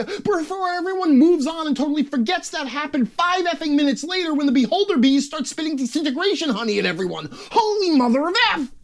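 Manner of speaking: very high, comic meltdown; exasperated rant. Acted. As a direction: let the tirade boil over into a squawk. Rant peaks, then feels better.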